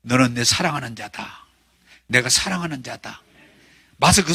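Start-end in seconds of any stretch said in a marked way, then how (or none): 0.0-3.2 softly voiced Lord's blessing, a bit lower; quiet loving assurance; lower, gentle voice of the Lord. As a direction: speak it low and tender, like God blessing a child. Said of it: "주님이 우리를 향해서 말씀해요".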